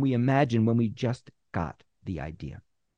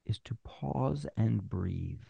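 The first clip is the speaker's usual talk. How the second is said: slow, deliberate; 7 dB quieter, slower than usual. Shown as a demonstration. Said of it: "When you pause and breathe".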